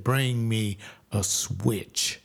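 low, very slow command; stern mother. Retold or imitated. Mother ordering her son to fetch a switch, low and slow.

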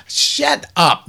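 high, loud cut-off; loud high retort; sharp shut up. Real, not acted. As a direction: snap them silent, pitched high and loud. Cutting off imagined critic.

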